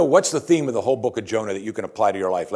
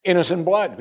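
higher-pitched acted appeal; pleading sailors; 7 semitones higher than usual. Sailors beg God not to make them drown Jonah, higher pleading. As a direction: plead the dilemma upward to God. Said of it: "And they said".

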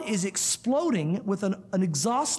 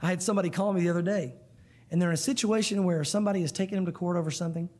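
normal talking voice

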